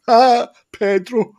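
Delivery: high, loud, drawn-out amazed cry; mock incredulity. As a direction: crow the name in loud, high-pitched disbelief. Retold reaction to a surprising name.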